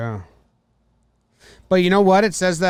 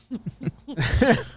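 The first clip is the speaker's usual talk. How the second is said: high laugh; 12 semitones higher than usual. Real, not acted. Laughing at own joke.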